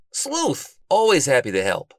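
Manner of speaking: high, loud, hearty hail; loud greeting. A narrator's reading. Bookworm greets the detective in the library, loudly. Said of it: "Bookworm said loudly".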